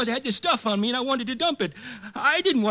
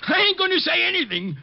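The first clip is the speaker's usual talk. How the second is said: defiant outburst; 9 semitones higher, 3 dB louder than usual. Acted. Old man refuses under pressure.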